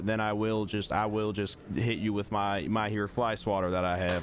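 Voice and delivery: put-on polite Southern accent, deadpan; country Southern accent. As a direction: play a courteous Southern country fellow. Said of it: "I'm stuck in this accent now".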